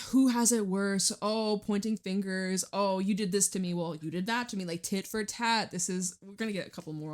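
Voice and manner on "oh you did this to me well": ordinary talk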